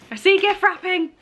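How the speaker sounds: a thrilled second take of "I see gift wrapping". Real, not acted.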